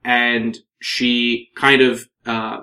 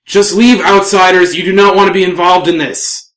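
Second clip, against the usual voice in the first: raised, forceful elf voice; warning elven woman; 6 semitones higher, 7 dB louder than usual. Acted. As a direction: warn the outsiders off, loud and higher. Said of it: "The elven woman will say".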